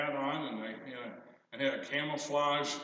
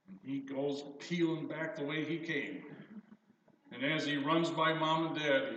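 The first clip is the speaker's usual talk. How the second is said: normal talking voice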